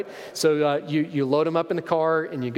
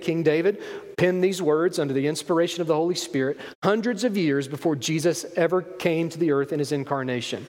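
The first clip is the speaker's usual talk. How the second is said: ordinary talk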